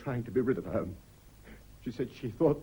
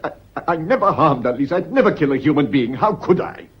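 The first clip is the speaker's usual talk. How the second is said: stammering, loud protest of innocence; desperate loud denial; 9 dB louder than usual. Acted. Husband denies killing his wife, loud and desperate. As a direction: plead and insist at once.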